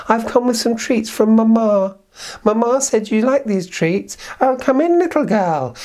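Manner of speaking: put-on high, light child voice; a lightly voiced little girl; girl's voice. The girl brings treats to grandmother, high and light.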